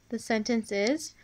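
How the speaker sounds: ordinary talk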